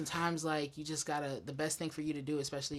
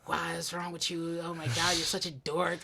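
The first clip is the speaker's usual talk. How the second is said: scolding inner voice; 3 semitones higher, 4 dB louder than usual. Retold or imitated. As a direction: scold yourself like a bully. Mocking his own inner critic.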